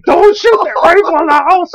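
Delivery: hammed-up frantic wife; wife's panicked plea. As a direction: shriek it like the scared wife. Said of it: "She's like".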